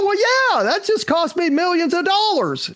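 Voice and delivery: very high, wailed retort; mock crying outburst. Crying agent defends herself to her client, mock wail. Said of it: "that's what you're crying about".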